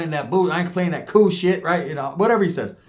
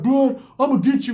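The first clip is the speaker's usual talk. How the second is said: mock threat yell; 9 semitones higher than usual. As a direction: call after him like a beaten kid. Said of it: "He's like".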